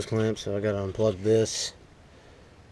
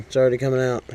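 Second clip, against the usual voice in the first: usual speaking voice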